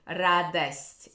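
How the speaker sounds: радость with an A sound after the Д; Moscow Russian. Shown as a demonstration. Teacher repeating the Moscow pronunciation of радость. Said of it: "It's more A after D".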